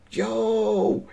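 high, drawn-out exclamation of shock; shocked disbelief. Retold or imitated. Viewer reacting to seeing him shot, stunned. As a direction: re-enact being stunned, pitch shooting up.

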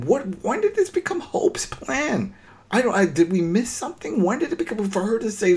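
can't believe what the show did; incredulous outrage. Real, not acted. Host venting to co-host about the plot, high and incredulous.

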